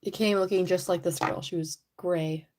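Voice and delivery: usual speaking voice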